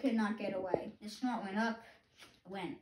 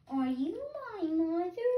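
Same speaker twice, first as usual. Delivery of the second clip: high and slow, hopeful question; a chick drawing out its hopeful ask; baby bird voice; 9 semitones higher, 5 dB louder than usual. Acted. Baby bird asking the hen, high and slow. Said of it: "my baby bird voice".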